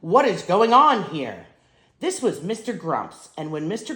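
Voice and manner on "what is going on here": a loud, angry demand; furious shout. A narrator's reading. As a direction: bark it at the whole crowd. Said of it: "charged with fury, shout".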